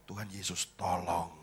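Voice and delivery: low, hushed little prayer; soft, low-pitched imitated prayer; private plea. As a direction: mutter a plea for help to yourself. Acted alarmed prayer at driver's photo.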